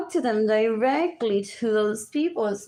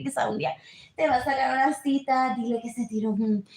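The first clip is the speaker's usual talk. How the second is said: normal talking voice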